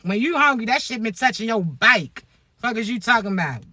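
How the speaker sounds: ordinary delivery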